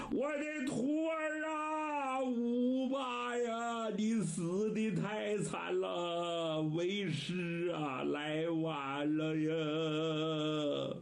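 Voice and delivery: put-on an old monk keening over his dead disciple, voice breaking into song; wailing lament. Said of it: "老和尚是放声痛哭". (放声痛哭，拖长腔哭唱)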